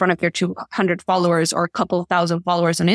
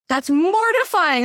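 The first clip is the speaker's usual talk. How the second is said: high, drawn-out mock embarrassment; mock mortified; 11 semitones higher, slower than usual. Guest retelling her reaction to botched sayings, mock mortified. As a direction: wail it slowly in playful horror.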